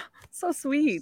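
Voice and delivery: soft, slow, high gushing. Retold or imitated. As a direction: coo it like it's adorable. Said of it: "I was like".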